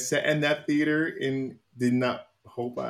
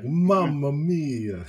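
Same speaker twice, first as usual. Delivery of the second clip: slow, higher Italian Mario voice with a laugh; Mario catchphrase; 6 semitones higher, much slower than usual. Retold or imitated. Quoting Mario's catchphrase from the movie, laughing Italian voice. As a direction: drag out a cartoon Italian exclamation. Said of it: "that real traditional Mario sound".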